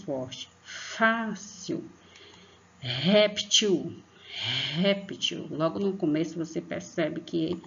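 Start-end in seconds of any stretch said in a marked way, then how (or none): 0.6-1.8 "fácil" called high and slow on "fá"; stress on first syllable. Shown as a demonstration. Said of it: "o fá é a sílaba mais". 2.8-5.5 "Réptil" with "Ré" pushed out hard and long; stress on first syllable. Shown as a demonstration. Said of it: "exige mais força pra gente pronunciar".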